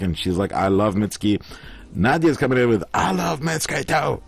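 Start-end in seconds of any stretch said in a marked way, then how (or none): 2.9-4.2 high, drawn-out fan enthusiasm; imitating an excited fan; mock gushing. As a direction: gush it slowly in a higher voice. Acting out an enthusiastic viewer comment.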